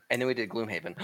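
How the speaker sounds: ordinary talk, nothing marked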